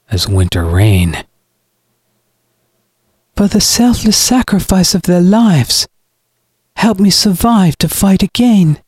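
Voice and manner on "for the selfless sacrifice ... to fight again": high-pitched preachy villain; cold, self-righteous brother. Acted. Brother justifies the killings to his sister, coldly righteous. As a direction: proclaim it with cold conviction.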